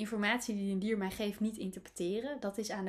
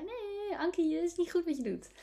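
little ego voice; 10 semitones higher than usual. Acted. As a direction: put on a high, chiding little voice. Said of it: "zo'n ego-stemmetje die dan zei".

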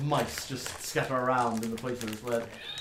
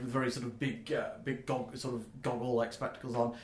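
ordinary talk

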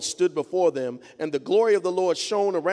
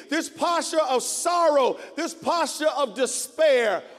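much higher and louder, crying out in despair; anguished cry to God; 9 semitones higher, 5 dB louder than usual. Acted. A weary soul crying out to God in agony, anguished shouting. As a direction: wail your lament up to heaven. Preacher voices anguished lament prayer.

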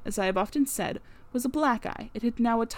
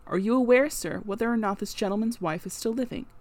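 usual speaking voice

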